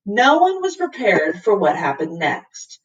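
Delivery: plain reading voice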